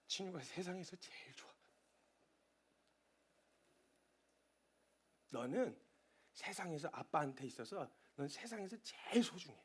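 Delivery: soft tender whisper. Retold or imitated. Father whispering love into his son's ear, soft. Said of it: "가서 귓속말로 얘기해줘요".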